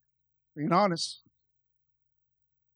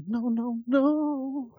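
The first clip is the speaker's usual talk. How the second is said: frustrated refusal; 9 semitones higher, 4 dB louder, much slower than usual. Retold or imitated. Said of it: "And I'm like".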